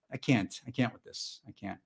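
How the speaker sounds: soft, fading away to almost nothing; mock-exhausted, trailing murmur; overwhelmed giving up. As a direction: run out of steam, defeated. Gives up after mock rant.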